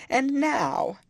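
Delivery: a high, tearful sob of a line; sobbed. A narrator's reading. Hurt young woman, pitched high and tearful. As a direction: Isabella near tears, complaining to Catherine. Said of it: "sobbed Isabella".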